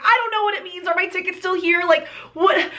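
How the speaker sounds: high-pitched, flustered retelling; flustered reply. Retold or imitated. Retold flustered reply to teller.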